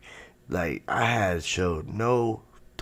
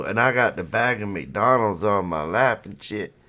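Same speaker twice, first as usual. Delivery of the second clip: ordinary talk, nothing marked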